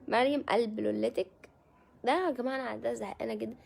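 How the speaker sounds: ordinary talk, nothing marked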